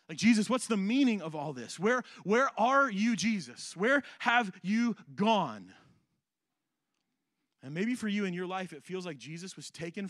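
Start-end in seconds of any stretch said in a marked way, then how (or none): 0.2-5.7 loud pleading call, slowing down; anguished cry. Pretend. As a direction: cry out to Jesus, desperate and drawn out. Voicing anguished cry to Jesus.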